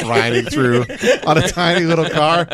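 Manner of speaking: ordinary talk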